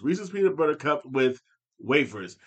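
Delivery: ordinary talk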